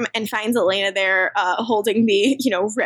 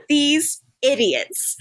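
ordinary talk

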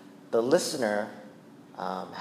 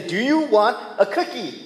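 higher sarcastic taunting voice; sarcastic mockery; 10 semitones higher than usual. Preacher mocking someone fishing for credit, higher and sarcastic. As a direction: offer the trophy mockingly. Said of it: "you say".

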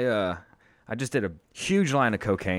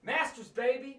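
high hype cheer for the big day; excited hype; 13 semitones higher, 7 dB quieter, slower than usual. Real, not acted. Cohost cheering on-air that it's Masters day, hyped. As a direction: whoop it up like it's a holiday.

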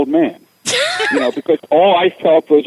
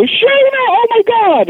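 very high, loud excited squeal; squealing teen girls; 4 dB louder than usual. Retold or imitated. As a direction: squeal it like a thrilled teenage girl. Girls greet friend, squealing.

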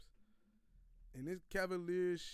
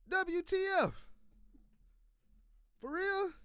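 incredulous; 12 semitones higher, 5 dB louder, slower than usual. Real, not acted. Disbelief at team's loss.

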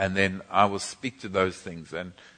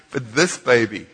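normal talking voice

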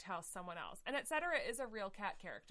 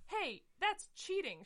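much higher, slow mock protest; indignant kitten; 11 semitones higher, 4 dB louder, slower than usual. Tinkerbell protesting that Mistopheles cheated, mock outrage. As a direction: drag out the outrage.

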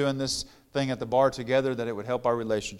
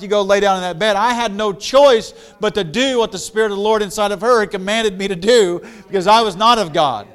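louder, higher preacher's fervor building; preaching; 7 semitones higher, 9 dB louder than usual. Acted. Preacher tells the congregation how his wife's faith silenced him, fervent preaching. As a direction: preach it out, swelling with conviction.

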